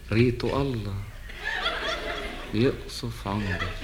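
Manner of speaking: slow, drawn-out cursing with laughs; arabic mother scolding. Acted. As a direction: stretch each curse out, half laughing. Drawn-out curse at Khalil. (دعاء بطيء ممطوط مع ضحك)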